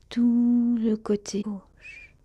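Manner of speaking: "tout" held long and slow, then easing down; drawn-out meditation phrase. Real, not acted. Guide closing the left side of the body, slow drawn-out "tout". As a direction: linger on "tout" before releasing the rest.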